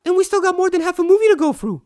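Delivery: mock alarm. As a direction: ham up the panic over the time.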